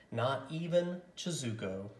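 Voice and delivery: usual speaking voice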